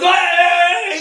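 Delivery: high, loud shout across the field; pitcher shouting. Retold or imitated. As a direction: yell it out as a warning from the mound. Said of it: "And he goes".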